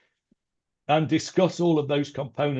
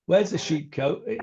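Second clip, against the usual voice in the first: ordinary delivery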